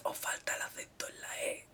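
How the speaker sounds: whispered aside. Real, not acted. Podcaster whispering a correction to listeners, whispered. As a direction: whisper it like a secret. Aside about missing accent.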